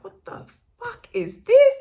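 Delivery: high, loud, slowed-down disbelief; exasperated outburst. Retold or imitated. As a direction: blurt it out, baffled and fed up. Reacting to a sudden trigger.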